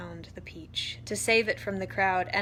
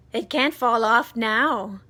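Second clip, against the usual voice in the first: shout; 2 semitones higher, 10 dB louder than usual. A narrator's reading. Aunt Sponge shouting at the peach, loud. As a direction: shout it out to her sister. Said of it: "Aunt Sponge shouted".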